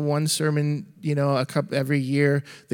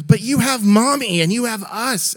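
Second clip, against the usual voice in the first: put-on higher, louder little girl protest; child voice; 8 semitones higher, 6 dB louder than usual. Daughter protesting to her dad, higher and louder.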